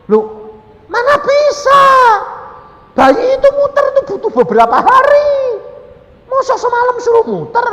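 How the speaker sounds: very high, loud protest; incredulous shouting. Retold or imitated. Husband disputing that a baby turns overnight, incredulous shouting. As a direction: wail the objection at top pitch. Husband disbelieves his wife.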